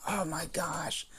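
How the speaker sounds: breathy whispered gasp of dismay. Retold or imitated. Whispering to listeners about the blocked movie, dismayed. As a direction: drop to a whisper on oh my gosh. Retelling her disappointment, whispered aside.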